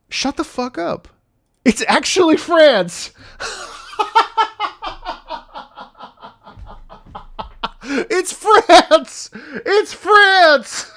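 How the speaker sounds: high shrieking, laughing astonishment; real disbelief. Real, not acted. Streamer discovering France leads the audience, shrieking disbelief.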